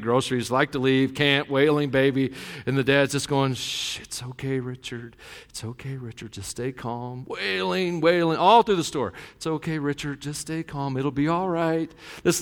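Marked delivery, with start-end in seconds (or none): 3.5-7.2 put-on much softer, shushing a wailing baby; hushed soothing dad. 9.4-11.9 much softer, gently soothing; hushed soothing dad. Retold or imitated. Dad keeps soothing the baby.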